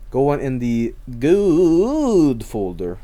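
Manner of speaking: very slow, savoured catchphrase; drawn-out catchphrase. Acted. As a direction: stretch it out like a ritual phrase.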